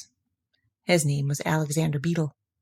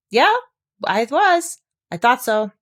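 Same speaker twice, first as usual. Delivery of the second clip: startled realisation; 6 semitones higher, 5 dB louder, slower than usual. A narrator's reading. Pooh after the thistle.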